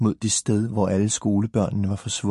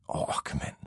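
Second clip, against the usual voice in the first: breathy, voiceless sigh of longing; wistful sighed grumble; 10 dB quieter than usual. A narrator's reading. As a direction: let the words fall out on a sigh. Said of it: "sagde Frode og sukkede".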